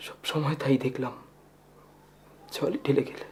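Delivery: soft, subdued reproach; quiet, let-down murmur; bitter resignation. Acted. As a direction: let the reproach sink almost under the breath.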